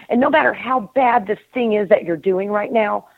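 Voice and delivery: normal talking voice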